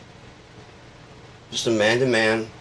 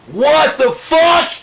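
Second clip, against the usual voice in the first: high, loud, slow shriek of disbelief; shocked doctors; 11 dB louder, much slower than usual. Acted. Stunned doctors reacting to the grades, high shocked shriek.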